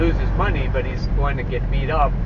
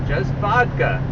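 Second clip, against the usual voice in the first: mock ad read; 6 semitones higher than usual. Mock vodka commercial read.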